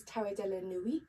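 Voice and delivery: "Tarot de la Nuit" said the French way, with "Nuit" as "nwee"; a light, playful French accent on "Tarot de la Nuit". Shown as a demonstration. Vlogger naming her tarot deck to viewers, in a light French accent. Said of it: "in my favourite French accent".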